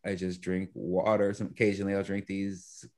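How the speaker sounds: ordinary talk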